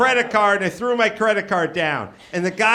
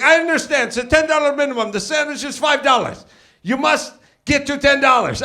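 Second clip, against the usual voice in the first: high-pitched, adamant counter guy; insistent clerk; 5 semitones higher than usual. Retold or imitated. Counter guy explaining the minimum to customer, high and insistent. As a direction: lay down the rule, voice pitched up.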